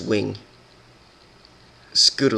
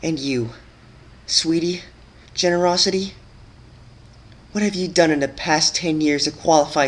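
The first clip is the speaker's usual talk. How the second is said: Twilight's bitter rebuke; 6 semitones higher, 3 dB louder than usual. A narrator's reading. Twilight to Sweetie Belle, scornful then grieving. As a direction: shame her, then grieve for Rarity.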